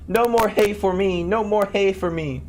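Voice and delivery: high, loud, slow repeated wail; desperate cry. A narrator's reading. Tom Thumb in the cow's stomach, high slow desperate cry. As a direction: wail it out as loud as you can. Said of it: "cried out in his extremity as loud as he could".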